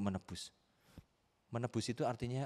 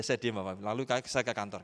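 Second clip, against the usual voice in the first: normal talking voice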